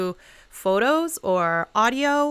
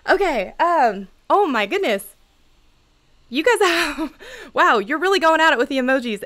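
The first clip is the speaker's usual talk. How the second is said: excited, overwhelmed; 6 semitones higher, 5 dB louder than usual. Real, not acted. Flooded by live chat emojis.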